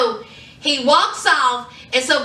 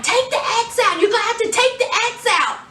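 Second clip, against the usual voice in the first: imitated urgent order; 8 semitones higher, much faster than usual. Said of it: "V was like".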